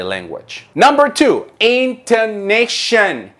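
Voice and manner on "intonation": intonation, high and drawn out; stretched intonation. Shown as a demonstration. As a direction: say intonation slowly with a high falling tune. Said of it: "Do you see the difference?".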